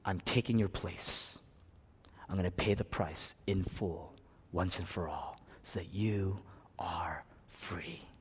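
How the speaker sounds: low, soft, slow solemn quotation; hushed promise. Retold or imitated. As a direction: voice Jesus's promise almost in a whisper. Jesus's promise, voiced solemnly.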